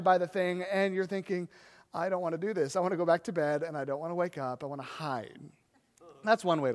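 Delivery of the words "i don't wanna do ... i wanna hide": weary dread. Retold or imitated. Tired person dreading the day.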